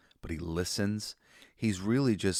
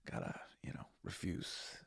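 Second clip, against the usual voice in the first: whispered self-talk, much softer; inner voice whisper; 6 dB quieter than usual. Retold or imitated. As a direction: whisper it to yourself as a pep talk. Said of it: "that I'm like".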